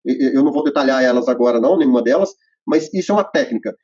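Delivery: usual speaking voice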